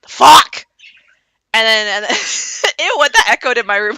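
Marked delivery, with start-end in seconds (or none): 0.0-0.7 sudden loud, high exclamation; shocked shout. Retold or imitated. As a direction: blurt it at full volume, startled. Dominic shocked by the apparition.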